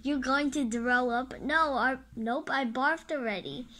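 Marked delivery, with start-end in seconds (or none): none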